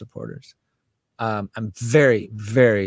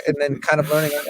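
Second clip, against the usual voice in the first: ordinary delivery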